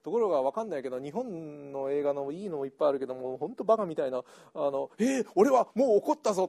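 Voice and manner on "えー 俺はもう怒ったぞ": hammed-up angry movie actor, higher and louder; mock a wooden, over-explained film outburst; stilted movie line. Mocking bad Japanese films with a hammy angry line. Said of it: "なんか説明口調の".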